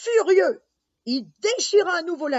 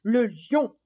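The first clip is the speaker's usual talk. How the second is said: ordinary delivery, nothing marked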